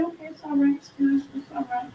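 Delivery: high, hushed acted reassurance; soft placating. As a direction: play keeping the peace, softly. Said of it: "Keep your mouth shut".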